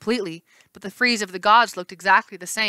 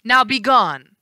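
commanding; 4 semitones higher, 7 dB louder, slower than usual. Acted. Hera dismisses.

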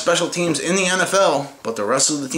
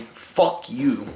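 usual speaking voice

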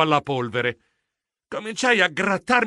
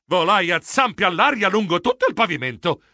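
ordinary delivery

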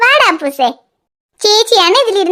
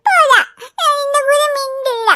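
crying; 5 semitones higher, slower than usual. Real, not acted. Daughter sulks, crying.

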